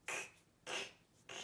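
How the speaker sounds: "K" as a bare "kh" click, not "kay"; phonics C sound. Shown as a demonstration. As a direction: sound out "K, k, k" as three short hard-C sounds.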